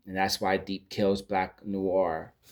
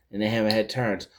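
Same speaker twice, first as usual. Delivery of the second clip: ordinary delivery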